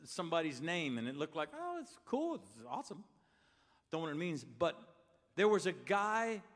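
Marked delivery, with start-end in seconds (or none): 1.5-3.0 mock casual admiration. People seeing pastor's hoodie, mildly impressed aside. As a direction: lightly imitate an impressed onlooker.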